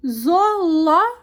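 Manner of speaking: Zoológico sounded out, stress on LÓ; teacher sounding out "Zoológico" with a strong "ló"; stressed syllable demo. Shown as a demonstration. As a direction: say "Zoológico" in pieces, hitting "ló". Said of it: "a pronunciada com mais".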